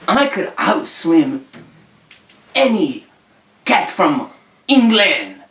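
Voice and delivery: cocky French challenger, deliberately slow; boasting French cat. Acted. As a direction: draw out each word in a mock French accent. Acts French cat's boast.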